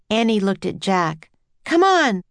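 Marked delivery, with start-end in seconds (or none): 1.6-2.2 high, loud call; urgent cry. A narrator's reading. Jack urging Annie toward the crowd, crying out. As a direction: urge someone along with a raised cry. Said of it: "Jack cried".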